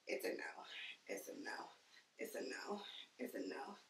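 fading down to a whisper. Real, not acted. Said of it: "repeated softer and softer".

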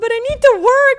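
tearful wail breaking into a laugh-cry; wailing through tears. Retold or imitated. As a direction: blurt it out between sobs and laughs. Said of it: "hysterically crying".

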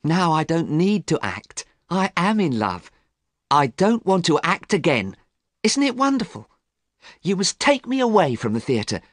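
put-on high, delighted young actress in love; happy lover's high-voiced outpouring; happily. A narrator's reading. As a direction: gush with joy, pitched well up. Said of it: "She spoke to him happily".

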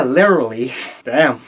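ordinary talk

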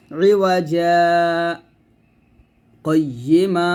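a short silent break between রিওয়াজা and কয়্যিমা without taking breath; saktah pause demo. Shown as a demonstration. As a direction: stop the voice briefly after রিওয়াজা, then carry on. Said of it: "আমার আওয়াজটা আমি বন্ধ করে ফেলছি".

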